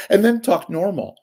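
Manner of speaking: ordinary delivery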